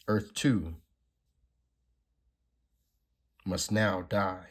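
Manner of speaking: lightly acted comic-book dialogue; dramatic comic reading. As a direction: read the panels like a dramatic script. Reads comic dialogue aloud.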